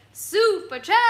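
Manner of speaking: high, loud showy announcement; playful high booming shout-out; fun voice. Retold or imitated. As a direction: imitate an animated streamer, punched up loud. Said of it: "a fun voice".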